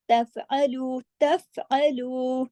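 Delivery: तफ़अलू with the फ़ held still, no bounce. Shown as a demonstration. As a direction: say तफ़अलू slowly with a steady फ़. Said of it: "तफ जमाव के साथ".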